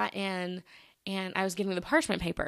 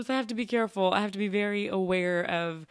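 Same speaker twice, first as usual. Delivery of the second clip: usual speaking voice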